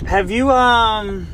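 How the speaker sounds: patronizing dental questioning; mock dentist question. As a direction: imitate the hygienist fishing for a confession. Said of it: "they're like".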